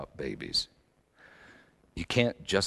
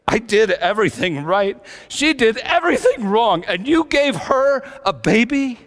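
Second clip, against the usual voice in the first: loud, high, anguished shouting of the wife's words; furious yell at God; 12 dB louder than usual. Retold or imitated. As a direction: scream it at heaven in a rage. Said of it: "she just started yelling at God".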